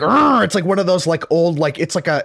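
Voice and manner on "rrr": engine growl. Retold or imitated. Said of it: "his car is like".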